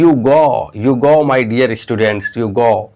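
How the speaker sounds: slow, drawn-out "you go" in the British way; teacher's modelled pronunciation. Shown as a demonstration. Teacher to students, modelling "you go" slowly. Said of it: "you go नहीं".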